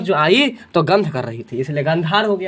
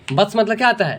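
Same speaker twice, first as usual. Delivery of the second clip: normal talking voice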